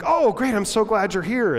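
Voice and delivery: high, loud fake delight; hammed-up glad-you're-here; mock cheery greeting. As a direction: sell a forced happy welcome. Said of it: "he's like".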